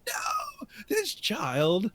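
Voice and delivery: high rising alarmed pleading. Retold or imitated. Watching the TV parent, pleading alarm. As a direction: cry out in disbelief. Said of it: "I'm like".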